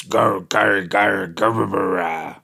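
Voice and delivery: low, mumbled mock grumble of an old man; grumbly Vince McMahon. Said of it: "rambling on in his grumbly voice".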